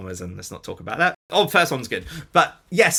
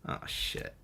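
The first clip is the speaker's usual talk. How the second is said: hushed, breathy oh shit; whispered curse; 14 dB quieter than usual. Retold or imitated. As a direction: mutter it under your breath in dismay. Crew reacts to his storyboards.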